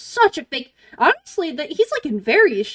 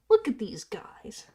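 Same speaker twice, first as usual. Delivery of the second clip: slow whispered adoration; 7 dB quieter, slower than usual. Real, not acted. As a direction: whisper it fondly. Repeats it in a whisper.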